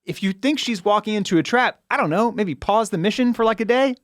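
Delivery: high, incredulous sarcasm; mock the plan's logic in a high voice; exasperated ridicule. Real, not acted. Cohost laughing at bad plotting, high sarcasm.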